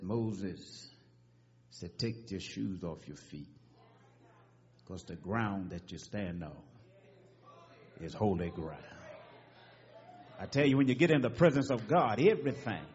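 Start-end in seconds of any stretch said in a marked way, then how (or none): 0.0-8.8 quiet, low, far-off divine voice; hushed, lower voice of God calling Moses. Acted. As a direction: speak as God from the burning bush. Voice of God from bush.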